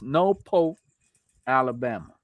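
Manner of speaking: "Norfolk" here said as "No-pope", no R's. Shown as a demonstration. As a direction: say "Norfolk" like "No-pope", two open syllables. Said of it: "That's how he wants it pronounced".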